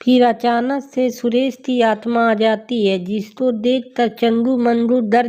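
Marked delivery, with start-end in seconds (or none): none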